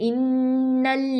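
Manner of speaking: इन्नल्लज़ीन slow with firm doubled nun and lam; slow recitation; tajweed recitation. Shown as a demonstration. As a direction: hold the doubled letters in इन्नल्लज़ीन. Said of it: "पढ़िए मुंह के अगले हिस्से से".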